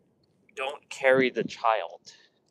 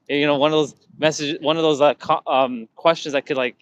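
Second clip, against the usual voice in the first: normal talking voice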